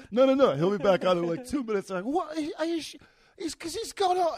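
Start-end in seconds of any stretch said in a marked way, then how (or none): 0.1-1.9 high, loud reassurance; telling the fans he'll be back; calling back. Retold or imitated. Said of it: "I turned around, I go". 2.0-4.4 high, disbelieving British voice; British fan in disbelief; British accent. Retold or imitated. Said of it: "very good English accent".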